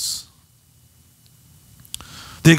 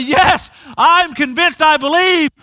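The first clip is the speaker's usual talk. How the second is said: mock scream; 9 semitones higher than usual. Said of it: "you scream loudly".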